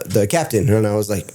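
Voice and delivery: ordinary delivery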